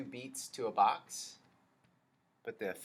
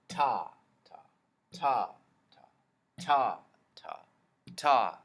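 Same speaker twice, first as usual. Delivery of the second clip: some ta punched louder than the rest; accented ta; 2 semitones higher, 8 dB louder than usual. Shown as a demonstration. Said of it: "say it again".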